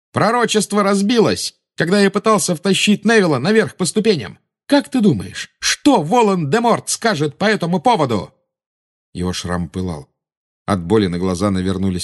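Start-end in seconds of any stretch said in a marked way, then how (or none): 0.1-8.3 high, heated, raised voice; defiant taunting. A narrator's reading. Boy tells the witch the prophecy broke, taunting her. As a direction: throw it at the enemy, voice high.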